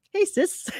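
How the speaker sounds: high, sweet "hey, sis"; friendly greeting. Shown as a demonstration. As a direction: greet her kindly, light and high. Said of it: "Call them".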